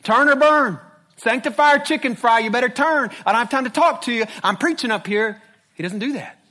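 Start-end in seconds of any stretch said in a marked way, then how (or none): 0.0-5.4 mock hellfire rant, loud and high; shouted fire-and-brimstone parody; screaming street preacher. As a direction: holler as if through a cheap megaphone. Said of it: "start screaming through it".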